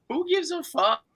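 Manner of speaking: a sudden high squeal of mock defiance; high mock shout. Mocking the queen's embarrassment, a high squeaky mock defiance. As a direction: jump way up and squeak it out.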